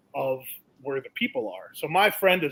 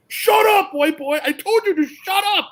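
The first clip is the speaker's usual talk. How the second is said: much higher and louder retold yell; mock scream; 7 dB louder than usual. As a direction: bellow it like an enraged jockey. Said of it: "Ivan Ortiz is screaming at him".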